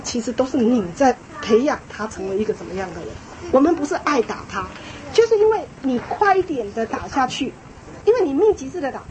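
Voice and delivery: ordinary delivery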